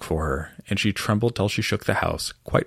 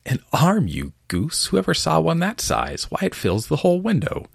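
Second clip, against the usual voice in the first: scolding rabbit; 10 semitones higher than usual. Acted. Rabbit scolding Pat over the arm.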